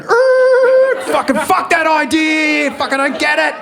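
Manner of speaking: very high, loud, slow bogan shout; hoon yell. Pretend. Comedian doing donuts in the metaverse, loud bogan yell. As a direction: bellow like a hoon in a ute.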